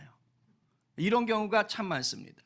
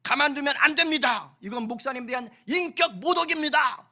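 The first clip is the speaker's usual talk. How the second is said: higher, louder, agitated protest; indignant staff; 8 semitones higher, 7 dB louder than usual. Retold or imitated. Staff protesting to Moody about the newspaper, agitated. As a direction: act out outraged helpers complaining. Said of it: "하면서 막 난리가 난 거예요".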